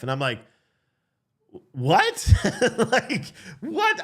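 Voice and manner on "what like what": high laughing disbelief; incredulous laugh, pitched way up; stunned what. Retold or imitated. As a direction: squeak out the what in shock. Stunned reaction to surgery news.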